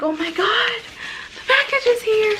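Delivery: high squealing quote of the moment it arrived; excited squeal. Retold or imitated. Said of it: "I was like".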